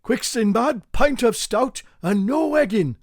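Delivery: high, loud panicked order; urgent shout. Acted. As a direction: bark it out in a hurry.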